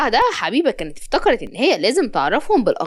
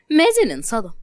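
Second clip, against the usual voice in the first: ordinary delivery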